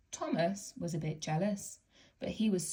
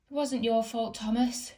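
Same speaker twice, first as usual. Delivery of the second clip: out of breath; 6 semitones higher, 5 dB louder than usual. A narrator's reading. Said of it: "catching his breath".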